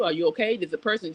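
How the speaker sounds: normal talking voice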